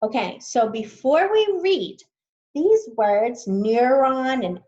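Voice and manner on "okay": ordinary talk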